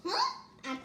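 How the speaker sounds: ordinary delivery, nothing marked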